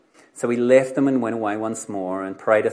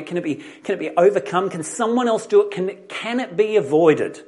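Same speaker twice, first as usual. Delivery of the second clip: acted urgent plea; 5 semitones higher, 5 dB louder than usual. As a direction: plead harder with each question, pitch and volume climbing. Voicing Jesus's desperate inner plea.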